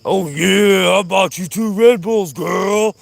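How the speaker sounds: loud, high, exaggerated put-on voice; hammed-up sweet talk. Vlogger boasting of Red Bulls to girlfriend, hammed-up loud high voice. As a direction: ham it up with stretched, showy words. Same line repeated, hammed up.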